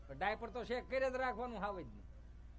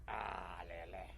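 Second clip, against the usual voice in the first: much lower, very slow; groaning drawl; 10 dB quieter, much slower than usual. Retold or imitated. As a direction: heave it out wearily. Handing baby off. (થાકેલો ભારે ઉચ્ચાર)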